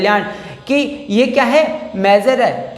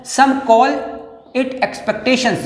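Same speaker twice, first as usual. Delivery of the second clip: slow, deliberate quoting of the poem line; slow recital; 3 semitones higher, much slower than usual. Shown as a demonstration. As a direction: draw out "Some call it expectations" as a recited line. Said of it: "तो क्या-क्या नाम देता है?".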